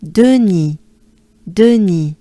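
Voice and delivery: Denis said the French way, stress on the last syllable. Shown as a demonstration. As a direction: Denis with a falling end, French vowels.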